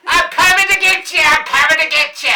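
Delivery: high, loud yell from a car window; Miss Brown hollering. Acted. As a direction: holler a threat across a cornfield. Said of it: "hanging out the window going".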